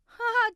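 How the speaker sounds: a big mocking bellow of a laugh. A narrator's reading. Desmond gloats over Cale's scorpion bite with a loud laugh. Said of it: "Desmond bellowed".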